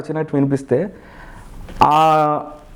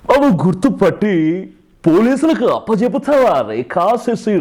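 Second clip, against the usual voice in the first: Chiranjeevi, early films; 2 semitones higher, slower than usual. Shown as a demonstration. Mimic recalling an early Chiranjeevi comedy film, swooping hero voice. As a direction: draw out the name, teasing old-film hero style. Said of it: "Chiranjeevi గారు అప్పట్లో".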